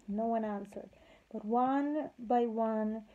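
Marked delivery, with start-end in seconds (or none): none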